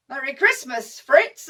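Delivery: loud call, punched on the name; shouted reply. Acted. Johnny returning the German's Christmas greeting, shouting. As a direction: call the greeting back across the gap.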